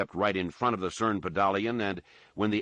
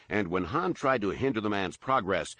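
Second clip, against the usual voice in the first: ordinary delivery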